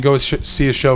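usual speaking voice

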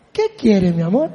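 gentle parent voice; tender parent. Retold or imitated. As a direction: ask the child kindly. Parent answering child.

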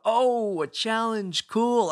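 bright eager burst, like a fighter relishing the fight; eager badass. A badass greets life's hardship, loud and eager. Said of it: "you might say to yourself".

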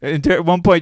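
ordinary talk

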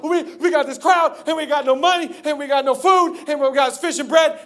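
high-pitched flustered complaint; acted fretting. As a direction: play overwhelmed disciples, high and worried. Disciples fretting about the crowd.

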